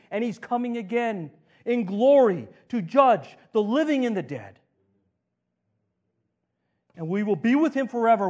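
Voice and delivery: full-force pulpit crescendo; preaching crescendo. Acted. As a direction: drive the call home. Altar-call crescendo of questions.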